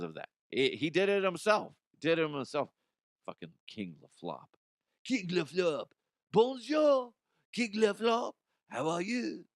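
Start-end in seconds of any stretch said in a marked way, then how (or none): 5.0-9.4 higher, hammed-up French accent; mock LeBron faking French; bad French accent. LeBron greeting someone in bad French accent. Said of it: "LeBron James trying to do a French accent".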